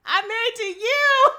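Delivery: high, loud, slow mock cry; hammed-up wail. Host playing the wife, loud mock wailing. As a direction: wail it like a soap opera. Hosts hamming up the movie line.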